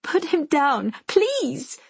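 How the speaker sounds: high desperate plea; begging. A narrator's reading. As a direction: beg him, voice rising in panic. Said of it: "Tatiana begs".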